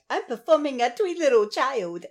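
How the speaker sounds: lightly voiced twee child; a hint of a precious stage kid; twee child actor. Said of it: "they're like".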